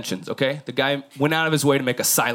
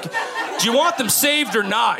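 high, slow, half-yelled demand; slow mock yell that pushes the offer; mock pushy shout; 11 semitones higher, slower than usual. Elon pressing the rescuers, slow mock yell. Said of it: "You're like".

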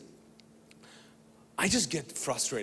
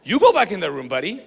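loud, high-pitched comic quarrel; arguing; 8 semitones higher, 9 dB louder than usual. Retold or imitated. Brother refusing to go back into the room, arguing loudly. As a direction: fire the order back, acting indignant. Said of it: "We argue for".

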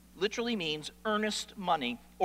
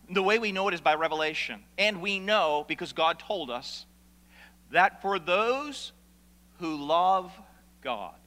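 usual speaking voice

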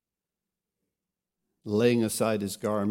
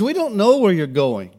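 louder, alarmed blurt; freaked-out Thomas; 7 semitones higher, 8 dB louder than usual. Retold or imitated. Thomas panicking as Jesus says he is leaving, loud alarmed blurt. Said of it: "Thomas gets all freaked out".

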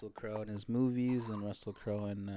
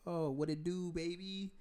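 put-on girl's voice; 7 semitones higher, 5 dB quieter than usual. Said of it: "And she's like".

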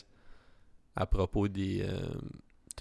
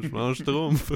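Schtroumpf en riant; 6 semitones higher, 5 dB louder, slower than usual. Retold or imitated.

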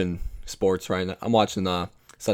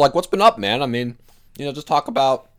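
usual speaking voice

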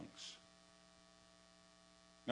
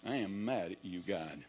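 sulking prayer; 6 semitones lower, 4 dB quieter, slower than usual. Retold or imitated. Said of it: "acting like it".

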